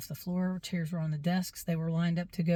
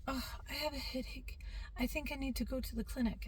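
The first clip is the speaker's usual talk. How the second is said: small, soft, higher poor-me voice; ailing child; 7 semitones higher, 8 dB quieter than usual. Retold or imitated. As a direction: imitate a kid playing up a headache. Child feigning headache to teacher.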